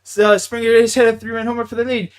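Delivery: loud, high-pitched excited recap; excited retold reply. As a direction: re-enact breaking the big news. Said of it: "And I'm just like".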